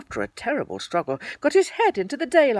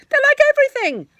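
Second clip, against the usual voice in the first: much higher, louder cheery Tigger boast; cheerful Tigger; 12 dB louder, faster than usual. A narrator's reading. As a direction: beam it out like a happy tiger. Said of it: "said Tigger cheerfully".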